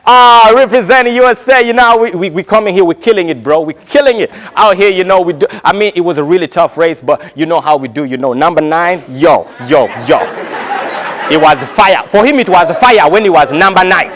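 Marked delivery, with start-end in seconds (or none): 0.0-12.0 mock New York swagger; New York accent. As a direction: boast it like a New York athlete.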